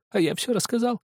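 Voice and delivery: high, trembling confession. A narrator's reading. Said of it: "и голос его задрожал". (дрожащим высоким голосом)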